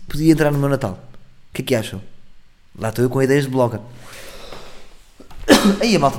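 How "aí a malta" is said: coughing mid-sentence; hacking cough breaking in; coughing fit. Real, not acted. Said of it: "estou com uma tosse gigante". (ataque de tosse)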